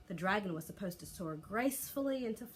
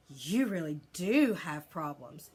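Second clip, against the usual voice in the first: slow and louder, full of wonder; stunned at what he just saw; awed; 8 dB louder, slower than usual. A narrator's reading. Friend watching a failed dragon lesson, awed and slow. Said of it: "he said in an awed voice".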